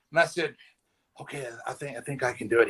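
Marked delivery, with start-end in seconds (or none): 1.2-2.7 very soft, hesitant agreement; a nervous yes you don't fully believe; quiet unsure reply; timid okay. Retold or imitated.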